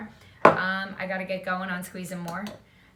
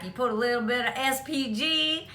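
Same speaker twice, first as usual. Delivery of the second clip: put-on southern accent; 3 semitones higher than usual. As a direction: lightly mimic a Southern barbecue host's accent. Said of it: "in their southern accent".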